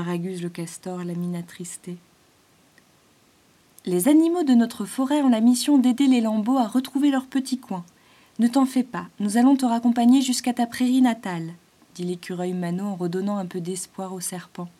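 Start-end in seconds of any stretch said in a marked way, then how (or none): 3.9-11.5 higher, louder eager squirrel; hopeful squirrel. Acted. Squirrel Mano promises to take the python home, higher and eager. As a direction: lift his spirits with a promise.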